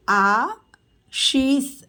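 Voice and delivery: Ashish stretched very slowly; slow teacher model of Ashish. Shown as a demonstration. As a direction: draw Ashish out sound by sound. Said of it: "teacher models name slowly".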